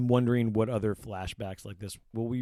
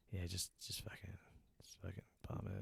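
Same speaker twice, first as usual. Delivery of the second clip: acted near-whisper, much softer; hushed grim advice; 3 semitones lower, 18 dB quieter than usual. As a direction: imitate her barely voicing the words. Voices scientist's grim advice in scene.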